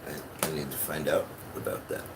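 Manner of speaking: usual speaking voice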